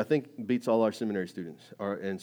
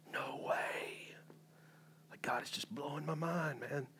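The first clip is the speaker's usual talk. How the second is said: breathless awed whisper; 4 semitones higher, 11 dB quieter, slower than usual. Retold or imitated. New believer overwhelmed by God, awed whisper. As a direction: hush it like your mind is blown.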